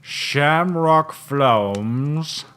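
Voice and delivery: slow, drawn-out offended repeat; indignant echo. Acted. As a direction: stretch it out in disbelief. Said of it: "I take offense to that".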